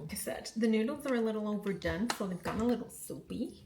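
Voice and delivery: light, half-slipped American accent; drifting into an American accent while cooking ramen. Real, not acted. Talking through her ramen in a light American accent. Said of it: "I'm doing an American accent".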